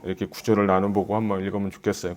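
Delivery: normal talking voice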